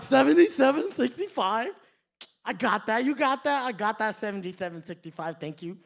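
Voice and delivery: loud mocking slur through braces; mock headgear speech. Joker turning to his girlfriend with the total, headgear impression. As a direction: keep mimicking the headgear, loudly. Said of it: "do the exact same".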